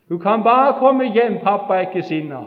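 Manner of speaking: loud, high, pleading call. Retold or imitated. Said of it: "gå ut og rope".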